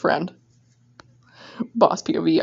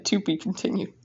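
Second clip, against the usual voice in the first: normal talking voice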